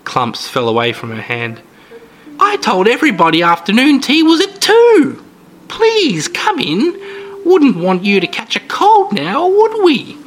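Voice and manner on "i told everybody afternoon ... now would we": put-on sweet, creepy old lady.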